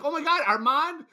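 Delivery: high, delighted gasp of recognition; thrilled to see an old friend; excited recognition. Retold or imitated. Sister recognizes him, delighted.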